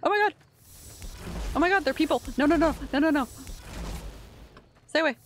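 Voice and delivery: high, loud terrified shrieks; panicked scream. Real, not acted. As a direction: scream it in horror. Panics at people in tanks.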